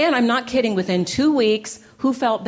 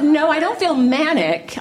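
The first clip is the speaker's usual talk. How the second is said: louder, laughing through it; laughing denial; 7 semitones higher, 4 dB louder than usual. Retold or imitated. Answering her colleague about mania, laughing. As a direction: brush off the question, laughing.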